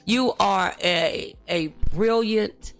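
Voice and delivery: ordinary delivery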